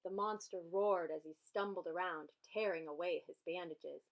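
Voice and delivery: ordinary delivery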